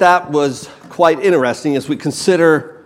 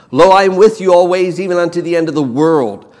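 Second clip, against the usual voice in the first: loud, higher and fast; proclaimed promise; 4 semitones higher, 6 dB louder, faster than usual. Acted. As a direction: declare it boldly to the congregation. Preacher proclaims Jesus' promise.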